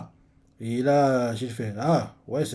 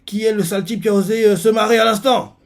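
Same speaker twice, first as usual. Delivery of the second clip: higher and louder, a fast angry challenge; 8 semitones higher, 6 dB louder than usual. Retold or imitated. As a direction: bark the question at the crowd. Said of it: "Shin continuer en disant".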